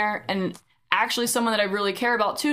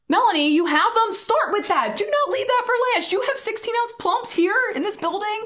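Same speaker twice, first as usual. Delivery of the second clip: much higher and louder, an exasperated, excited outburst; excited exclaim; 12 semitones higher, 3 dB louder than usual. Retold or imitated. Telling Melanie she already has the cups, a high excited outburst. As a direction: burst out at her as if the answer were obvious.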